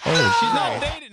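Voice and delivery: high, loud surprised oh; surprised correction. Real, not acted. Co-host correcting the [name] rumour, high surprised blurt.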